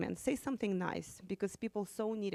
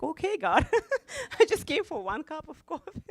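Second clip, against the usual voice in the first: 1 laughing disbelief; 8 semitones higher, 6 dB louder than usual. Retold or imitated. God asks her to pay more.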